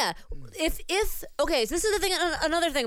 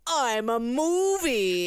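self-important movie; much slower than usual. Retold or imitated. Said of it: "a movie that's just like".

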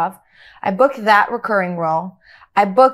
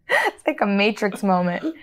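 ordinary talk, nothing marked

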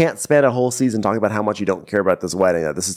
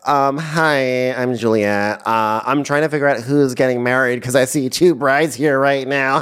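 raised, put-on sweet voice; mock Juliet; 5 semitones higher than usual. Retelling Juliet's entrance, raised put-on voice. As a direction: play the innocent newcomer. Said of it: "she's like".

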